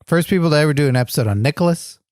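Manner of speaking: fast teasing parrot; mocking repeat. Cohost mocking the champion, fast teasing repeat. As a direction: rattle it off to mock him.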